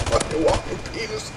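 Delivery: snickering under the breath, almost voiceless; retold schoolboy snicker, very quiet; stifled giggle. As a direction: whisper it through a suppressed laugh. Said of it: "So I giggled".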